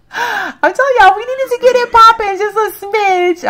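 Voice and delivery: high, loud gleeful insistence; excited outburst. Real, not acted. Streamer cheering on Sim couple, high excited outburst.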